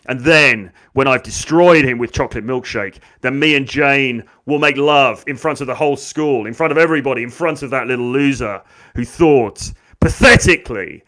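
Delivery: loud villainous monologue; hammed-up, loud bully's boast; gloating bully. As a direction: declaim it like a triumphant villain. Acted bully's grandiose villain speech.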